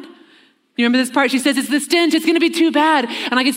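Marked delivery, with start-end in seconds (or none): 1.6-3.1 a lightly higher, fretful Martha voice; a hint of a worried sister fussing at his shoulder. Martha at Jesus' shoulder, lightly fretting about the smell. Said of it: "I imagine it kind of in this voice".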